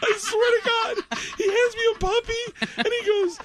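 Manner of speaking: laughing. Real, not acted. Telling the host about the puppy, laughing high. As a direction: tell it while helplessly laughing.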